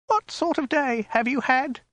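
very high falsetto, tremulously querulous question; squeaky, anxious wifely question; querulous woman. A narrator's reading. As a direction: ask it high and fretful, lifting the last word. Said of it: "she asked querulously".

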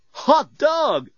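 high, whooping bellbot; delighted robot. A narrator's reading. Bellbot hears of colleagues, delighted. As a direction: exclaim with high-pitched glee.

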